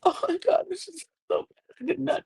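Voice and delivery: very high-pitched pitiful imitation; mock distress. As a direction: play up the victim for sympathy. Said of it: "gaining sympathy".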